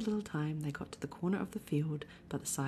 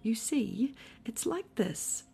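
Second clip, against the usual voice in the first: higher, uneasy lead-in; hesitant explaining; 5 semitones higher, slower than usual. A narrator's reading. Pooh tries to explain the house to Eeyore, hesitant. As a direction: stall while finding the explanation.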